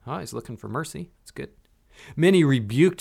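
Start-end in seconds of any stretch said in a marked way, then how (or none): none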